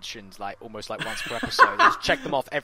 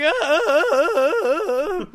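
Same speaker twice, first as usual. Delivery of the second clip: repeated loud, high mock wails; string of hammed-up "ah" cries, climbing; comic high-pitched stuttering yells; villain's dumbfounded cries; 9 dB louder, slower than usual. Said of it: "the bad guy's just standing there, like, going".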